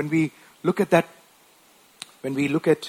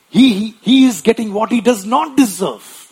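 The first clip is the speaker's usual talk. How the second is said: louder, insistent defence; earnest thief; 5 semitones higher, 7 dB louder than usual. Retold or imitated. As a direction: press the point like the repentant thief. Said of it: "that other thief said".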